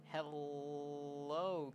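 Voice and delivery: HELLO with a long held L; Hello, held long before the last syllable; drawn-out L. Acted. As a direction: stretch the L in Hello on a level pitch. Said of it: "this time the L sound was longer".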